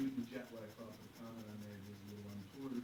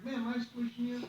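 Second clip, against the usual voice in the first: high, loud question to an elder; urgent appeal; 11 semitones higher, 7 dB louder than usual. Retold or imitated. Passenger asking older seatmate, urgent. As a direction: ask insistently, pitched way up. Acted appeal to old lady.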